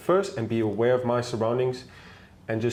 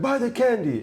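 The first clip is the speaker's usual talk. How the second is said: a hint of a pushy street seller, higher; pushy vendor; 12 semitones higher, 3 dB louder than usual. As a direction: demand it in a mock vendor voice. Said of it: "He's like".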